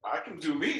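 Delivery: emphatic loud insistence. Real, not acted. Team lead insisting to her coach, loud and emphatic. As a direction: insist firmly that you can.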